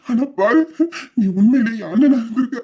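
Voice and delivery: ordinary delivery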